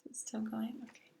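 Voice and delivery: whispering to herself; a quick hushed aside; whispered aside. Real, not acted. Reader's aside about recording.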